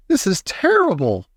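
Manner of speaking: a touch of a mother's wail. A narrator's reading. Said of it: "wailed Missus Teabee".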